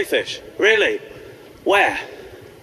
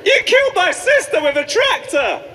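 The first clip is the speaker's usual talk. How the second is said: mock furious shout; 10 semitones higher than usual. Defeated bathmate screaming at the bubble beard, high hammed-up yell.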